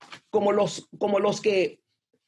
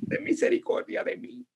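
whispered plea; 6 semitones higher, 5 dB quieter than usual. Retold or imitated. Sinner's humble plea retold.

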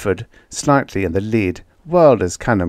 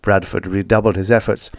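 plain reading voice

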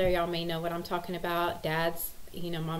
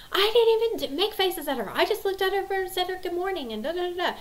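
much higher, quicker whiny protest; imitating protesting son; 12 semitones higher, 5 dB louder, faster than usual. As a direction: cut in like a defensive boy, pitched way up. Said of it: "throwing in his two cents".

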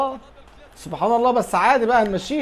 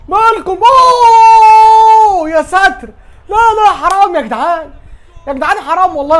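loud, high anguished yell; frustrated shout; 8 semitones higher, 8 dB louder than usual. Real, not acted. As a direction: cry out as it hits the bar. Shot hits the crossbar.

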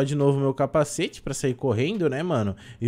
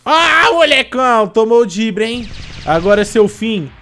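loud gloating taunt; triumphant shout; 7 semitones higher, 11 dB louder than usual. Real, not acted.

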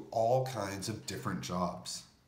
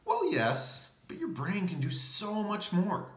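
excited; 9 semitones higher than usual. Acted. Narrator cheerfully answers own question.

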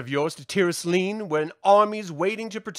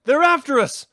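alarmed shout; 5 semitones higher, 6 dB louder than usual. Acted. Warning of pursuing troops.

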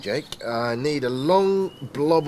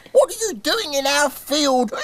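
high piglet voice; squeaky little piglets; grunting piglets; 12 semitones higher than usual. Acted. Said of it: "Grunted the three piglets".

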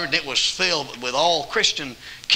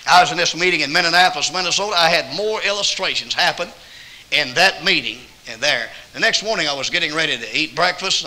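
normal talking voice

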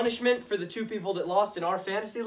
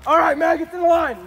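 shouted higher and louder; barking drill sergeant; 9 semitones higher, 11 dB louder than usual. Acted. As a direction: call the recruits to line up. Sergeant lines up recruits.

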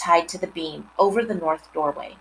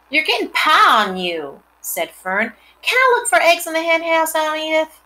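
put-on little girl; 10 semitones higher, 4 dB louder than usual. Girl voiced in story.

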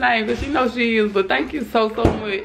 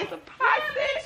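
excited squeal; 7 dB quieter than usual. Real, not acted. Unboxing gift pot set.

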